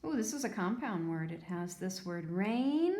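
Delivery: ordinary talk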